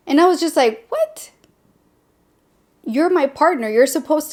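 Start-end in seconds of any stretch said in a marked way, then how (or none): 0.9-1.3 high, small incredulous "what?"; retold disbelief. Reacting to a boyfriend's competing, high-pitched disbelief. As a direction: squeak it in baffled disbelief.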